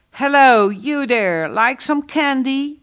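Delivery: playful put-on American voice; mock American soldier. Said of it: "Zo praten ze".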